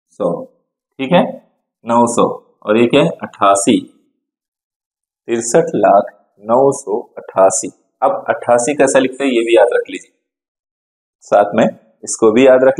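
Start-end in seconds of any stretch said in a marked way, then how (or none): 5.3-7.7 slow dictation. Shown as a demonstration. Teacher reads number slowly for dictation.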